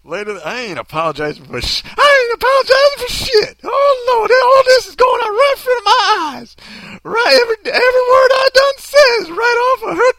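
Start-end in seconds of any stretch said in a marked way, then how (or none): 1.8-3.6 loud, very high cartoon maid; hammed-up high, hollering old-lady voice; put-on maid voice. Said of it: "you're gonna sound like this". 3.7-10.1 loud, very high cartoon maid; hammed-up hollering old-lady voice; put-on maid voice. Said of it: "you're gonna sound like this".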